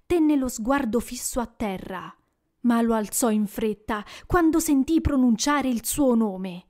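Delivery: plain reading voice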